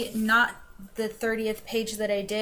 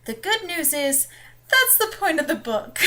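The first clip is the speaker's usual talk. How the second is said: higher and louder, bursting out; gleeful reveal; 7 semitones higher, 5 dB louder than usual. Real, not acted. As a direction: announce it with glee, building to a laugh. Punchline after bad news.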